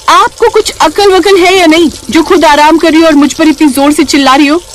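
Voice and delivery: high-pitched indignant scolding; acted outrage, pitched well up; angry berating. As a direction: tear into the sister-in-law. Naina berates her sick sister-in-law.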